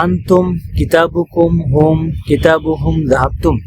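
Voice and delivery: slow, careful Arabic with the final মিম held silent; deliberate Quranic-style recital; Arabic reading demo. Shown as a demonstration. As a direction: read each word slowly and let the মিম close with sukun. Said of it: "শেষের মিমে সুকুন আছে".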